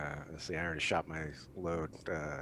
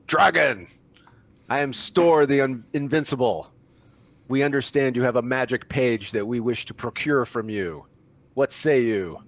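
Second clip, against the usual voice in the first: loud, higher, slow heroic proclamation; heroic declaration; 7 semitones higher, 12 dB louder, slower than usual. Acted. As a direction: announce yourself to the dragon like a champion. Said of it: "heroically jams his greatsword".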